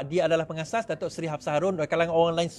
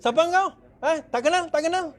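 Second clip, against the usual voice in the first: high-pitched haughty challenge; arrogant winner; 9 semitones higher than usual. Acted. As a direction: brush off supporters like strangers. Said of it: "Bila dah menang, ha, semua hilang". (lagak sombong selepas menang)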